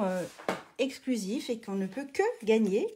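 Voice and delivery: ordinary delivery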